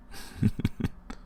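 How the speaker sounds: low breathy villain chuckle; soft evil chuckle. Acted. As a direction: chuckle under your breath with contempt. Vampire mocking her prisoner.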